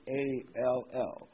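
A L L spelled letter by letter, slowly; spelling out all. Shown as a demonstration. Preacher spells out a key word to the congregation, slow and deliberate. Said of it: "I want to emphasize that word".

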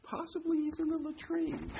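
high, teasing mock advice; sarcastic mockery. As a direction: needle them like a smirking heckler. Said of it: "in a very sarcastic, humorous way".

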